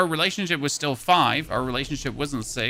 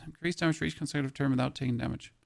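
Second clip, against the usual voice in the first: quick, softer rereading; fast quiet reread; 3 semitones lower, 8 dB quieter, faster than usual. Real, not acted. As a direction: rattle it off quietly.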